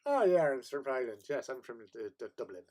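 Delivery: put-on Irish brogue, bursting in loud on the first words; a drunk's unconvincing Irish accent, trailing off; bad Irish accent. Claiming to be from Ireland to people in pubs, bad Irish accent. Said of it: "I started speaking with an Irish accent".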